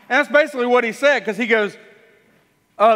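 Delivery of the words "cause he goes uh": normal talking voice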